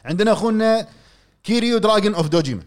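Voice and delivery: big hyped-up announcement; hype friend. Acted. Hyped introduction of a fan.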